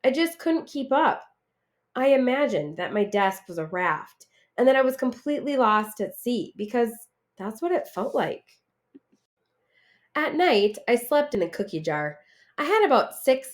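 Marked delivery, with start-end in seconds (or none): none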